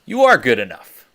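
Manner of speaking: loud, emphatic pep talk; encouraging coach. Retold or imitated. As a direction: pump him up. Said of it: "I'm like".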